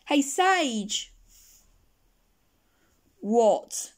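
usual speaking voice

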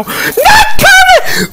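high, slow laughing shout; hammed-up loud grief; mock despair. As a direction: cry it out half laughing. Kermit eaten.

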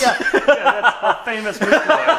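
high, loud, laughing through the words. Real, not acted. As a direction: speak while cracking up.

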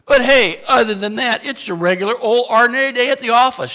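sarcastic breezy aside about the crisis; ironic understatement. Acted. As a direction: play it off like nothing's wrong. Ironic understatement amid disaster.

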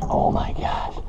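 soft, voiceless awe; stunned whisper. Real, not acted. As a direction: whisper it to yourself in disbelief. Stunned by the catch.

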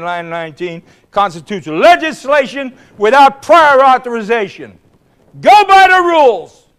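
loud, high House-floor oratory; floor speech. Retold or imitated. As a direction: address the chair at full volume. Retelling his own floor speech.

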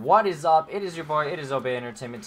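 loud, high-pitched YouTuber greeting; booming hello to the viewers; hyped video intro. Real, not acted. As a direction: kick the video off big and loud.

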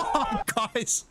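high, drawn-out curse of relief; overwhelmed exclamation. Real, not acted. Player telling viewers after winning, overwhelmed. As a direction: blurt it out high after the win. Just won the fight.

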